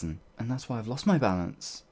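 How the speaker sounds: normal talking voice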